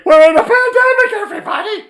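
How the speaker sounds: high, loud mocking panic cry; mock alarmed official. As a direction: ham up the doom-saying expert, shrill and loud. Mocking Fauci's earlier alarm.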